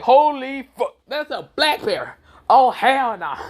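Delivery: loud, high-pitched alarm; hammed-up shock, voice jumping up; mock panic.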